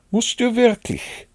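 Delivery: high, polite question. A narrator's reading. Rabbit asks departing Pooh, high and polite. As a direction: ask politely, pitched high. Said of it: "sagte Kaninchen höflich".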